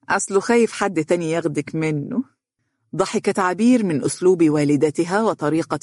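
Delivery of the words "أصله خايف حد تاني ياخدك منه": ordinary delivery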